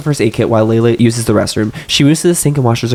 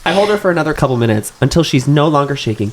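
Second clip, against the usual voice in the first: usual speaking voice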